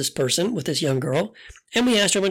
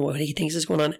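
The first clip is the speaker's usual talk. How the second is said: ordinary talk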